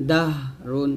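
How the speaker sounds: "দাহ" ending in a light breathed h; released air. Shown as a demonstration. Teacher showing learners the thin ha, airy h. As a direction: let air out on "দাহ" before "রুন". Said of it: "বাতাস বের করবেন".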